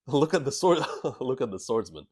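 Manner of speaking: reading through real laughter; giggling while reading the line aloud; laughing. Real, not acted. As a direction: laughs breaking into the words. Laughing while reading Zoro hype.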